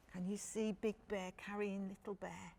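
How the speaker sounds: ordinary delivery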